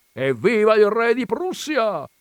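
high, loud, drawn-out hurrah; yelled cheer. A narrator's reading. Coachman cheering the king of Prussia, yelling. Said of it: "urlò il cocchiere".

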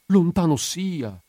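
high, slow, drawn-out warding-off; dismayed exclamation. Acted. Widow answering talk of a bad end, dismayed exclamation. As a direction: stretch it out in pious alarm.

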